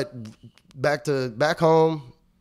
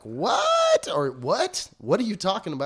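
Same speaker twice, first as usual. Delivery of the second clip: baffled; 8 semitones higher, slower than usual. Retold or imitated. Customer baffled by accusation.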